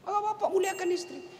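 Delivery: usual speaking voice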